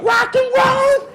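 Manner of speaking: put-on high, squeaky kid answering teacher; child's voice. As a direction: pipe it up like an eager child. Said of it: "second grade classroom".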